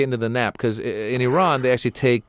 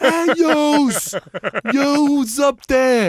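much higher mock Brooklyn holler; yelling up at neighbors; 5 dB louder, much slower than usual. Tenant hollering at upstairs neighbors, mock Brooklyn yell. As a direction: bang the ceiling and holler upstairs.

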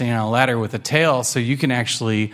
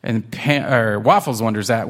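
plain reading voice

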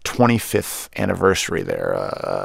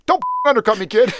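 high-pitched mock scolding; imitated angry politician, breaking into a laugh; Barack Obama yelling; 12 semitones higher, 4 dB louder than usual. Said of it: "all I can think of is".

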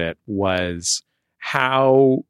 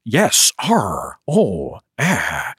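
hammed-up movie phone-call impression; mock an actor straining at an American accent; Liam Neeson; much slower than usual. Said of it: "answering the phone as Liam Neeson".

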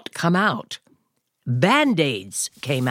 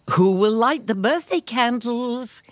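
higher, lightly voiced mother; mother asking; 8 semitones higher than usual. A narrator's reading. Mother asks who will light the candles, lightly voiced. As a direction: ask it like a cheery dragon mom. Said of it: "his mother asked".

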